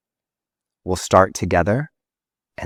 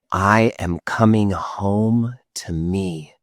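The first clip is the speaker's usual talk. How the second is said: slow affirmation; slower than usual. Shown as a demonstration. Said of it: "Go ahead and say that".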